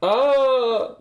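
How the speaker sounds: a sharp high yelp, loud; sudden shriek. Real, not acted. Skinning a cobra, startled into a sharp loud yelp.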